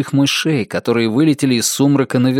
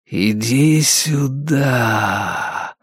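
very slow, eerie luring whisper; disembodied voice beckoning, drawn out; pleased temple whisper; much slower than usual. A narrator's reading. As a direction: stretch the vowels and let the consonants linger. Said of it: "В нём явственно слышались довольные нотки".

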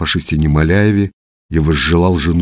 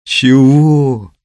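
high, louder narrated cry of protest; sharp rise in pitch and volume; 7 semitones higher, 4 dB louder, much slower than usual. A narrator's reading. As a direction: yell out to be let go. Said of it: "крикнула Людмила".

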